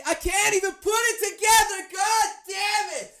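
angry yell, alone in the room; frustrated. Retold or imitated. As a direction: yell at yourself over the gun. Rage over assembling the gun.

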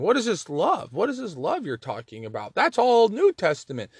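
high, loud doubting voice of a sceptic; a voiced sceptic in the congregation, high and loud; sceptical objector. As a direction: push back like a doubter who thinks you are wrong. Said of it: "now you might be wondering".

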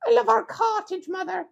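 very high whiny mock-Italian kid; Italian child. Child begging mother to stay outside, squeaky Italian accent. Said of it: "I'm your Italian child".

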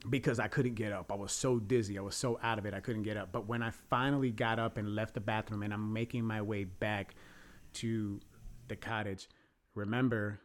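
ordinary talk

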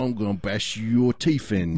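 slow, caricatured English threat; mock English accent. Fake Englishman threatening a stranger, hammed-up English accent. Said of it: "Just do the accent".

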